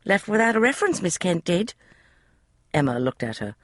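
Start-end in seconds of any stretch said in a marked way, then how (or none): 0.1-1.7 put-on high, eager young maid's voice; a chatty servant girl, pitched high; maid Polly. Maid Polly explaining the room to Emma, high eager voice.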